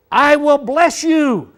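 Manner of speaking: loud, high, slow declaration. Shown as a demonstration. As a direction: raise your voice on every word for emphasis. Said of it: "We'd raise our voice".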